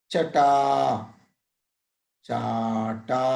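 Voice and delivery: word-list drill, one word at a time with long pauses; slow drill reading. Shown as a demonstration. Teacher drilling short and long vowels: slow, careful recitation. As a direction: read each word deliberately for pupils to copy.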